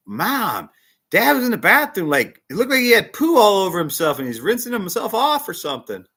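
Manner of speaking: put-on high, loud tattling kid; boy running to tell his mom; excited child. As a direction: blurt it out like a shocked little boy. Son telling mom about dad.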